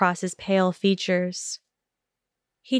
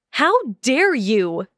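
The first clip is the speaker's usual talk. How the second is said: a mildly raised, outraged cry; outraged scream; 4 semitones higher than usual. Acted. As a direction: push the outrage up, only half a scream. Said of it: "Hermione screamed".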